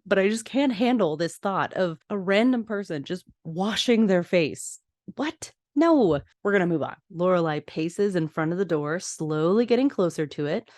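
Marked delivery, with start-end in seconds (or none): 5.1-6.2 high, sharp "What? No." in real disgust; shocked disbelief. Real, not acted. Host recoiling at a stranger washing face in sink, shocked. Reacting to a gross habit.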